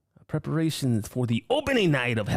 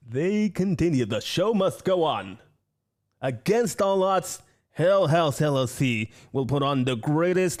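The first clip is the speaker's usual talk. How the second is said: grand proclamation; 3 semitones higher, 4 dB louder, slower than usual. Acted.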